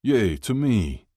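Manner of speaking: slow, high, loud cheer. A narrator's reading. Kip answers his own toast, cheering. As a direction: cheer for yourself, drawn out. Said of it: "Kip cheered".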